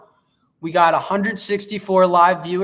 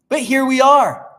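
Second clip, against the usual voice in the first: high loud announcement; dramatic declaration; 8 semitones higher, 9 dB louder than usual. Acted. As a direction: proclaim it grandly. Mock dramatic line.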